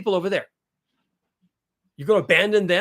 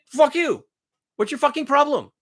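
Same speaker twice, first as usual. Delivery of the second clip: angry outburst; 8 semitones higher, 4 dB louder than usual. Acted. Ranting at imagined objectors.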